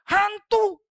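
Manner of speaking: high, loud terrified shout; shout of fear. Acted. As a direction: scream it at the ghost. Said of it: "mereka teriak".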